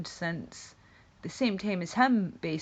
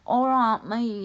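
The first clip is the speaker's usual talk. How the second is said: put-on Scouse accent; 5 semitones higher, 8 dB louder, slower than usual. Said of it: "he just doesn't have that".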